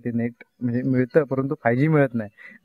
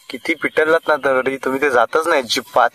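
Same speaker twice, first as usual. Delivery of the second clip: ordinary talk, nothing marked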